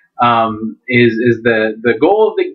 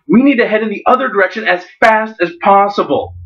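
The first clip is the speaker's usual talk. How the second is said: urgent; 7 semitones higher, faster than usual. Acted. Dougal urges fleeing fast.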